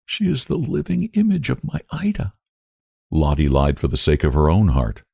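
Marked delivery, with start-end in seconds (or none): 0.0-2.3 put-on a mother choking up over her lost girl; mild tearful quiver, a bit softer; breaking voice. A narrator's reading. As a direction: voice cracking with held-back tears. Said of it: "spoke in a breaking voice".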